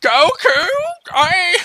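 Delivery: put-on high-pitched old master voice; Masaroshi. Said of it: "tried to sound like Masaroshi".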